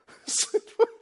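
loud, high burst of laughter. Real, not acted. Preacher laughing at tongue-talkers who avoid people. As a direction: laugh at the irony.

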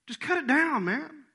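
high, loud, impatient order; truth, no grace. Acted. Fed-up vineyard owner to his keeper, impatient. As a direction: order it impatiently, raising the pitch. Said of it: "but no grace".

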